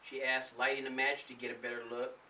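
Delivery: ordinary delivery, nothing marked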